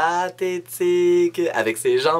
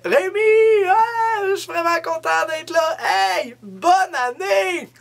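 put-on drunk guy greeting. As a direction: ham up the tipsy date arriving. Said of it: "Il fait".

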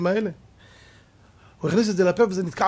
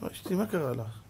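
timid husband; 4 semitones lower, 11 dB quieter than usual. Acted. Husband timidly asks upset wife.

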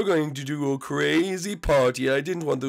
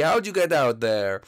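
usual speaking voice

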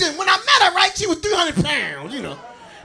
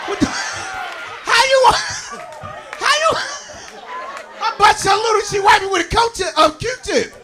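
incredulous disbelief; 5 semitones higher than usual. Retold or imitated. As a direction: sputter in comic disbelief. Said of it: "I'm like".

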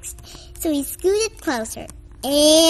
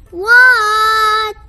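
loud, higher yelled what; loud reply; 7 semitones higher, 10 dB louder than usual. Acted. Froggy answers the librarian, loud yelled reply. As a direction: shout back without thinking.

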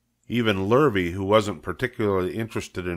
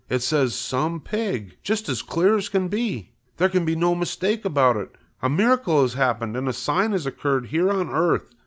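convince your wife, more fervent each line; building excitement; 6 semitones higher than usual. Acted. Husband insists on miracle.

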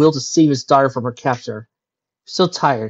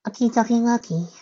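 sighing; 10 semitones higher than usual. A narrator's reading. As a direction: sigh it out in a high little voice. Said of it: "sighed the candlestick".

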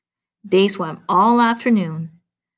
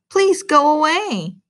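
pleading; 8 semitones higher, 4 dB louder than usual. Acted. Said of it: "cried Frog".

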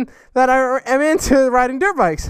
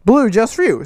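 ordinary delivery

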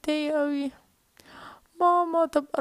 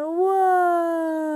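long, drawn-out wow; amazed wow; 4 semitones higher, 3 dB louder, much slower than usual. Acted. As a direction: savor the wonder. Kid amazed by the bounce. (واو ممدودة بانبهار)

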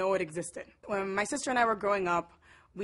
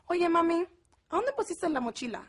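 higher, singsong Mexico City Spanish; chilanguita accent; 7 semitones higher than usual. Retold or imitated. Sister asking mami about the backpack, high chilanguita accent. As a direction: imitate a very Mexican sister, pitched up. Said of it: "My sister has her little chilanguita accent".

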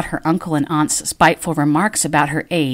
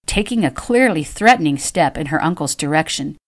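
usual speaking voice

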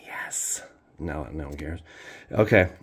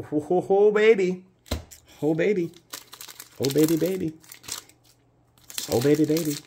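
excited cheering; 10 semitones higher, 5 dB louder than usual. Real, not acted. Cheering at pack totals.

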